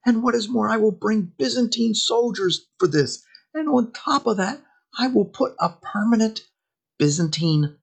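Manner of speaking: high-pitched mock grand promises; eager promiser. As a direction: play an over-eager pretender piling up offers, voice pitched well up. Acting Alexius's grand promises to crusaders.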